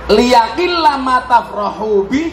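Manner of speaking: normal talking voice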